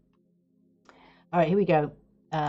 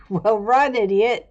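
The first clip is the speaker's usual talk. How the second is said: teasing shout; 4 semitones higher, 9 dB louder, slower than usual. Real, not acted. Yelling at clip character.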